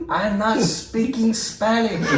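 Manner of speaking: slow, high imitated protest in thick accent; nice but elevated. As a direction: imitate a nice man getting a little exasperated. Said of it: "his voice is a little bit elevated".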